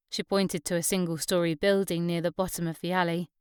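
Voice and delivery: ordinary delivery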